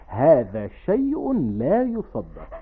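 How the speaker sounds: "هذا شيء لا يصدق" very slow, falling at the end; imitating the family catchphrase. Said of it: "كلهم يقولون". (تقليد ممطوط لعبارة "هذا شيء لا يصدق")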